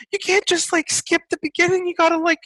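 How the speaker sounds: high, scolding mock voice; high-pitched mock lecture.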